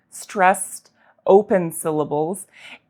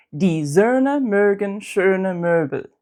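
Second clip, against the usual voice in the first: Die Söhne mögen schöne Möbel slowly, each Ö long and tense; slow practice sentence; slower than usual. Shown as a demonstration. Teacher modelling practice sentence slowly for learners. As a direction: say it slowly, stretch every Ö. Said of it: "here's a sentence".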